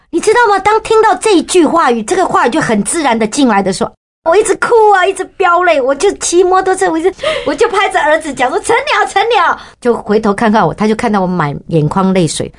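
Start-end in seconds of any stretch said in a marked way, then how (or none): 0.1-9.6 much higher, rising with tears; choked-up high excitement; overwhelmed retelling. Retold or imitated. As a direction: relive the moment you burst into tears. Retelling weeping on the motorbike.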